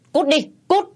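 high, explosive order to leave; angry high-pitched yell; furious shout. A narrator's reading. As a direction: shout them out of the house. Husband throws wife out.

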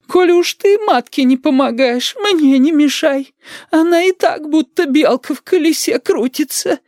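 very high, slow granny reproach; high, drawn-out grandmotherly lament; martyred grandmother. A narrator's reading. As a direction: whine it reproachfully as an old woman, pitched right up.

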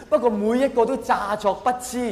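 ordinary talk

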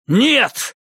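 high, sharp cry of refusal; shout. Acted. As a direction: shout it out in protest. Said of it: "крикнул я".

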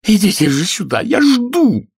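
loud, very high squealed order; impatient feminine shriek; screechy shout. A narrator's reading. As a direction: cry out shrilly, voice near breaking. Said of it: "визгливым, срывающимся голосом закричала".

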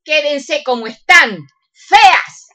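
high, loud offended snap; huffy outburst. Acted. Offended beautician snaps at her ungrateful friends in a huff. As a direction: spit out the final insult.

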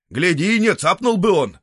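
очень высоко и громко; крикни предостережение толпе; предупреждение. Acted. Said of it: "предупредил некий зритель". (зритель громко и высоко предупреждает толпу)

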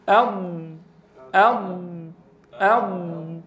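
"أض" repeated three times, ض from the tongue's edge; repeated model sound. Shown as a demonstration. Teacher drilling students, repeating "أض" clearly. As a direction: drill "أض" slowly, keeping the air trapped. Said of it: "حاول أن تتدرب على ذات الكيفية".

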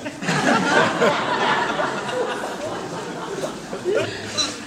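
risata alta e beffarda; risata acuta di scherno. Acted. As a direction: ridi acuto e beffardo. Ride del puffo. (risata acuta di scherno per il candidato puffo)

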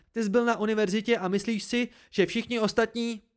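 high, indignant attack; angry accusation. A narrator's reading. Dena resents his university schooling, angry accusation. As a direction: keep attacking, pitched high.

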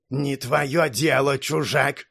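slow, icy dismissal; cold. Acted. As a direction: hold him at a cold distance. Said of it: "всё так же холодно ответил".

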